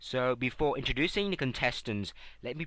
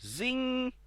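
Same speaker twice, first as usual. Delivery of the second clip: high ringing buzzer zing; buzzer sound; 10 semitones higher than usual. Pretend. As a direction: imitate a quiz show buzzer, pitched high. Imitating the game buzzer.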